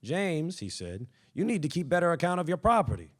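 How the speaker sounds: light mocking jab, tossed off fast; teasing. A narrator's reading. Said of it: "Terrence liked to tease his brother".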